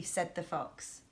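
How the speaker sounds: ordinary delivery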